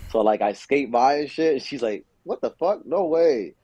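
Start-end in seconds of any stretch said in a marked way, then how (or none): 2.2-3.5 mildly amazed, higher-pitched quote; retold amazement. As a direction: imitate her disbelief on spotting you. Retelling friend's amazed reaction on set.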